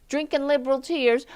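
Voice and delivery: usual speaking voice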